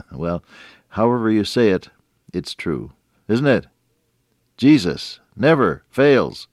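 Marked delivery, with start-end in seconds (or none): 4.6-6.5 loud, high, slow preaching; emphatic proclamation. Real, not acted. Preacher assuring listeners that Jesus never fails, emphatic proclamation. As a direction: punch each word out slowly and strongly.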